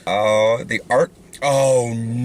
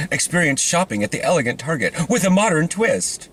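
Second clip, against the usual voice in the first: higher-pitched mock promotional voice; parody ad read; 5 semitones higher than usual. Parodying how Portland gets described, mock ad read. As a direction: spoof a marketing slogan, pitched up.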